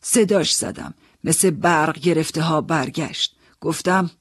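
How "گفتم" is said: usual speaking voice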